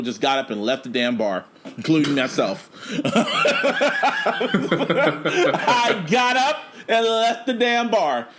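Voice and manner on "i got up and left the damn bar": laughing. Real, not acted. Laughing while retelling leaving bar.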